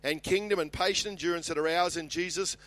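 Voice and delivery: high, loud, ringing Bible reading; Scripture proclamation. Acted. Preacher reads John's vision to the congregation, proclaiming loudly. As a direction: read it out boldly to the whole church.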